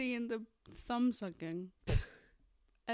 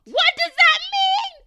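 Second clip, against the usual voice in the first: loud, high, drawn-out mock shriek; mock outraged yell; 14 dB louder, slower than usual. Answering Chelsea's odd line in the retelling, mock shriek. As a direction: shriek it in exaggerated bafflement.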